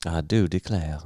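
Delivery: Southern belle. Acted. Showing friend a Southern belle, lightly mocked accent. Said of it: "like, a Southern belle".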